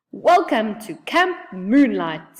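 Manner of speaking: slow, gruff, grumpy greeting; a hint of a grumpy gnome, unhurried. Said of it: "said a gruff voice".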